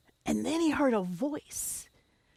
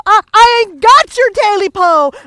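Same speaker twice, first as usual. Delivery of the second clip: frightened man; 8 semitones higher, 19 dB louder than usual. Acted. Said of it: "that man was so afraid".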